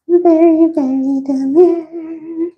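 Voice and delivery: high, drawn-out mincing delivery; high, slow sing-song catchphrase; put-on sing-song. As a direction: stretch it out slowly on a high held pitch.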